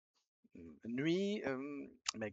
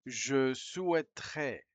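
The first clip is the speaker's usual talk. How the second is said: slow and careful, like reading out to a class; slow model reading; 2 semitones higher, 6 dB louder, slower than usual. Shown as a demonstration. Teacher reading the French phrase slowly for learners. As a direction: lay each syllable out slowly.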